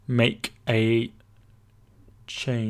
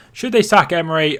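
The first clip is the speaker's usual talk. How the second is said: louder, with "they" pushed; louder repeat; 5 semitones higher, 7 dB louder than usual. Real, not acted. Streamer repeating a chat question to viewers, louder. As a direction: put the question to the room.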